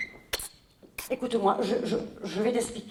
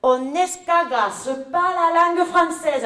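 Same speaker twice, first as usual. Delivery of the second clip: la prof imitée; 6 semitones higher, 6 dB louder, slower than usual. Retold or imitated. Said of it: "ce qu'elle me répond".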